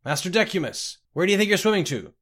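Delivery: high, loud, quick call. A narrator's reading. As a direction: call after someone swimming away. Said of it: "He called to Scotty".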